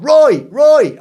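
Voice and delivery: high, loud calling out across a crowd; shouting a name. Retold or imitated. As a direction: yell Roy to grab the photographers. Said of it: "started shouting out".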